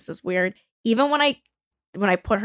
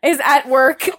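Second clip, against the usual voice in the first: normal talking voice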